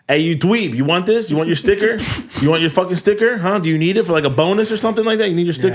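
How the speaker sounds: high, teasing questions; mocking taunt. Teller taunting the tow guy with the sticker, high mocking.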